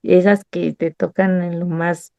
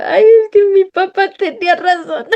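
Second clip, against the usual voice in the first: high, laughing, mock-tearful wail; half laughing, half sobbing admission; a playful teary confession; laugh-cry whine; 10 semitones higher than usual. Crying-laughing self retold.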